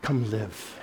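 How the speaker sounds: soft, low invitation; quiet tender call of Jesus; gentle invitation. Retold or imitated. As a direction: invite tenderly, almost hushed. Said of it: "and he says".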